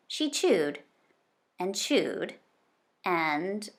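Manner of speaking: plain reading voice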